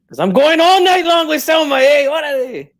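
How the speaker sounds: high, loud excited whoop; elated outburst. Pretend. Elated about night with Selma.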